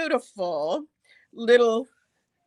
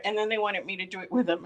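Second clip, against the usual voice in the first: ordinary talk, nothing marked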